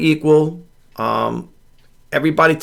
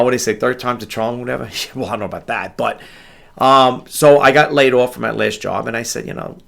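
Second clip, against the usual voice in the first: usual speaking voice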